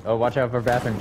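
normal talking voice

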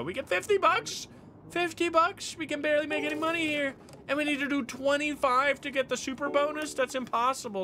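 high, fast outraged disbelief; indignant disbelief. Real, not acted. Streamer outraged at a tiny payout, high and indignant. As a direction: squeal it out in disbelief.

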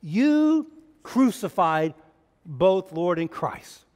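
"You" drawn out, slow and loud; stress on YOU. Shown as a demonstration. Preacher closing the deal with the crowd, slow loud stress on "You". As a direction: lean hard on "You crucified". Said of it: "that word "you" is the emphasis".

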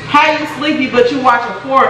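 ordinary talk

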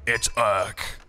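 slow, drawn-out line-up call with long pauses; measured, suspenseful roll call; player-introduction announcer. Acted. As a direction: announce each contender like a ring announcer.

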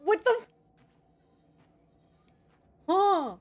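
high-pitched disbelief; baffled outburst. Real, not acted. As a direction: cry out in bewilderment at the game.

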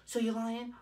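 high-pitched imitation of a mother; lightly mocked maternal suspicion; accusing mom. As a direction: ask it as a pointed challenge. Mom accuses him of lying.

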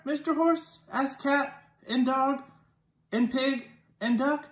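plain reading voice

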